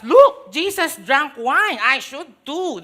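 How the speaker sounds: a believer using the Bible as a license, smugly; mock smug excuse. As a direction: ham up a smug churchgoer quoting Jesus. Mock believer justifying drinking.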